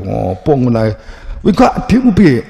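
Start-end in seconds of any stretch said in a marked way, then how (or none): 1.4-2.4 higher, louder, fast mock scolding; mock scolding sibling. Older sibling scolds the crying younger one, in a higher, louder, hurried voice. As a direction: act the bossy older sister, much higher and faster.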